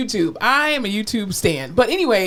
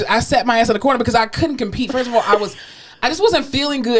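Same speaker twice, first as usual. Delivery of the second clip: ordinary talk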